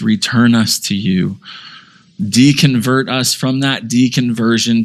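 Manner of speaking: closing sermon prayer, humble and sincere. Acted. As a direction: pray over the congregation.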